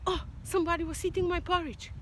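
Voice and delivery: lightly voiced daddy bear, high and loud with a sob; a hint of a tearful daddy bear; mock-upset bear whining. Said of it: "Daddy bear goes".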